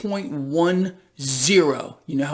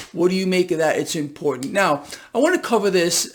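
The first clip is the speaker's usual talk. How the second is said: ordinary talk, nothing marked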